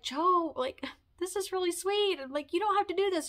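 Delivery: high, pleading "you don't have to"; touched protest. Retold or imitated. Retold protest to a generous friend.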